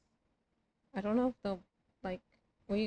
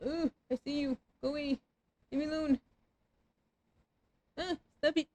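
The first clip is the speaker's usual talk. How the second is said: being chased; 6 semitones higher, faster than usual. Real, not acted. Ghosts chasing again.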